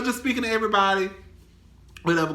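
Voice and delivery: normal talking voice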